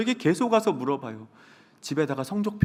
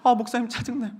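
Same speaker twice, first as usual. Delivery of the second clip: higher, whining complaint; whiny student; 7 semitones higher than usual. Retold or imitated. Student grumbling to the pastor, whining higher. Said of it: "뭐 이렇게".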